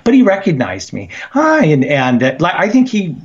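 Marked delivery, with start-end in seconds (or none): 1.3-1.7 much higher, chirpy greeting; bright friendly hi. Retold or imitated. Caller imitating Mead greeting him, chirpy high hi. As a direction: pop the hi way up like a happy hello.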